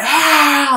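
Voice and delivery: short high mock scream "Ah"; mock outburst scream. Kid showing the scream Vader meant, high yelped ah. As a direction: blurt out a yelled "Ah". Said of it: "he just meant to just scream".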